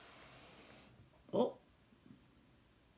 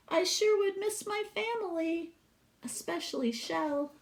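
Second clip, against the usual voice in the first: put-on higher, childlike Sylvia voice; little girl voice; 9 semitones higher than usual. Teacher voicing young Sylvia reading her poem, childlike and wistful. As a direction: lift into a small girl's wistful voice.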